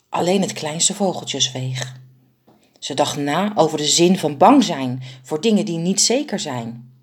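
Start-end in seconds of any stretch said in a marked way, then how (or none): none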